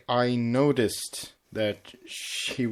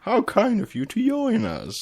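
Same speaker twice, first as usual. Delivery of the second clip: villain line; 10 semitones higher than usual. Acted. Reading villain quote aloud.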